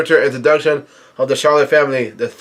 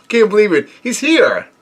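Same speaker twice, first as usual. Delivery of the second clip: high, giddy, laughing through the shock; half laugh, half gasp of joy; laughing disbelief; 7 semitones higher than usual. Real, not acted. Fan sees a beloved character return, giddy disbelief.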